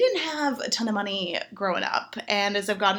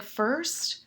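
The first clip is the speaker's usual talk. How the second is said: softer, low bedroom voice; mock seductive man; 3 semitones lower, 6 dB quieter than usual. The gentleman describing demolition, a soft mock seductive murmur. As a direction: murmur it like dirty talk. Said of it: "He's like".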